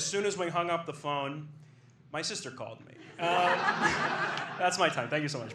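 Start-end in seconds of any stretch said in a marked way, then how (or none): 3.6-4.5 quick whispered giggle; a breathy chuckle to close out; short laugh. Real, not acted. Laugh before sign-off.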